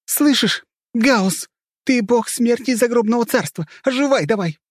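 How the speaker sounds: very high, urgent shouted plea; frantic high-pitched command; desperate invocation. A narrator's reading. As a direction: yell at the dead man to wake up. Heroine commanding him to revive.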